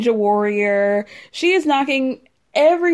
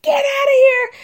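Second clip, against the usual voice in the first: high, loud screamed order; imitated scream; 5 dB louder than usual. Acting out a cast member at the producer, screaming. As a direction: scream it far above your normal pitch. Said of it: "you can hear her scream".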